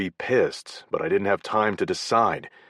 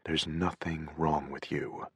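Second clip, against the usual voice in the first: gentle reassurance; 8 dB quieter than usual. A narrator's reading. Logan comforting softly.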